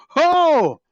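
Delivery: loud, high drawn-out "ho!"; big wowed exclamation, hammed up; imitated amazement. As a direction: mimic an astonished moviegoer crying out. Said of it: "he was genuinely amazed every time".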